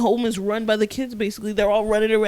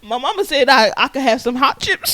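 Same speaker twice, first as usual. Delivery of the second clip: put-on louder, higher Southern kid voice; mock Southern accent, raised voice; 4 semitones higher, 6 dB louder than usual. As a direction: insist like a Southern child. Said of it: "why did we get a southern accent".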